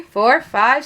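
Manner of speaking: plain reading voice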